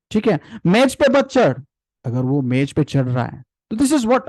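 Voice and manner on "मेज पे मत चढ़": higher, sharp telling-off; harsh scolding. Shown as a demonstration. A parent scolding a child climbing the table. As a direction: bark the order at the child. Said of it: "आपकी voice बहुत बड़ी होती है".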